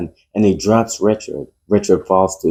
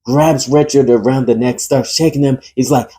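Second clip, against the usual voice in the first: ordinary talk, nothing marked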